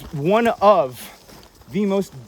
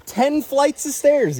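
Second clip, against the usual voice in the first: mock dramatic boast; 10 semitones higher, 5 dB louder, slower than usual. Joking brag about short climb.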